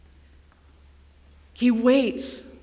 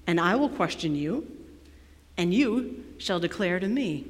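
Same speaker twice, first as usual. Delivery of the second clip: lower, softer, measured reading; solemn scripture reading; 6 semitones lower, 9 dB quieter than usual. A narrator's reading. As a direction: read God's challenge with quiet authority. Reads God's speech from scripture.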